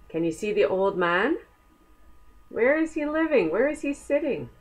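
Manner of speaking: ordinary delivery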